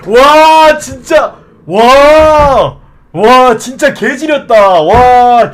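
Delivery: loud, high elated wows; excited shouting. Real, not acted. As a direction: yell with joy. Hit jackpot upgrade.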